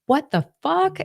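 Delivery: high, stunned exclamation; shocked. Retold or imitated. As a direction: blurt it out in disbelief. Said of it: "being like".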